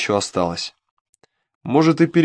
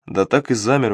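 usual speaking voice

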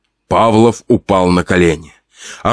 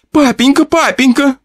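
very high, frantic tearful calls; crying out in tears. Acted. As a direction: call for father in terror. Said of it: "кричала сквозь слёзы Лизонька".

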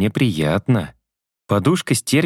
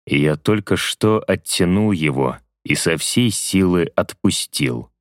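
usual speaking voice

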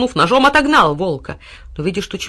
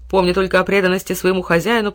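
ordinary delivery

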